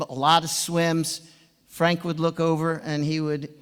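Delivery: ordinary delivery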